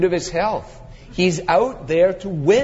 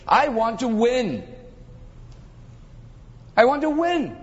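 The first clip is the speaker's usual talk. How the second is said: impassioned declaration; 5 semitones higher than usual. Acted. Preacher voicing Paul's drive to win souls, impassioned declaration. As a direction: pitch climbing on each repeat. Paul's driving ambition, climax of list.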